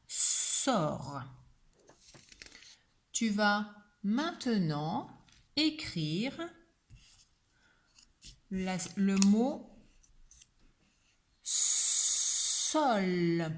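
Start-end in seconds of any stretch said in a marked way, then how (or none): 0.1-1.2 "Sort" with long s and no t heard; silent T. Shown as a demonstration. Teacher shows the silent letter, "Sort" drawn out without t. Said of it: "mais ne s'entend pas". 11.4-13.5 "sol" slow, long s and held l; sounding out. Shown as a demonstration. Teacher dictates three sounds to pupil, "sol" stretched slowly. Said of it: "il y a également trois sons".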